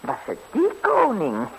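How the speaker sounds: ordinary talk, nothing marked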